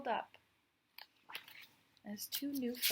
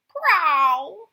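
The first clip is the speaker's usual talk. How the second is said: high, much louder cat cry; impatient cat's prow; 7 semitones higher, 17 dB louder than usual. Acted. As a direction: demand it like a cat at the door. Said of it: "he said again".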